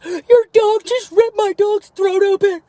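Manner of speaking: high, loud, slow put-on wail; mock distressed neighbor. Imitating the neighbor's staged panic about his dog, high and slow. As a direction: ham up a man faking panic over his dog. Neighbor's dramatic false claim.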